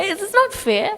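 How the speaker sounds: high, aggrieved mock complaint; mock protest. Chastised disciple protesting a reaction, mock aggrieved. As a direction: pitch it up in wronged protest.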